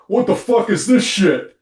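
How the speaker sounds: mock angry husband snapping at breakfast. Husband gripes at his wife over breakfast coffee, mock angry. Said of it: "and he's like".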